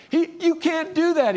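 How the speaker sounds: high, sharp objection; indignant churchgoer. Retold or imitated. Churchgoer scolding Scott for skipping church, high and indignant. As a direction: cut him off, scandalised. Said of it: "he said".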